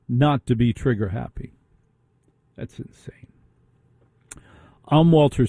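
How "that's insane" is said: hushed, voiceless aside; barely audible, incredulous whisper; whispered disbelief. Real, not acted. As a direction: whisper it as if stunned by the rules. Host closes rant in disbelief.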